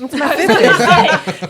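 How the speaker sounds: loud, high laughing retort; laughing protest. Real, not acted. Teased about her fifth favorite pick, laughing protest. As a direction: protest slowly through a laugh.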